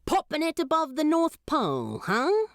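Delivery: quick sly demon teasing the angel. Acted. Demon character voice.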